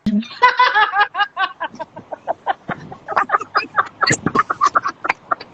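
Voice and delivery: giggling fit; high breathy laughter; long high laugh. Real, not acted. Laughs at telephone voice.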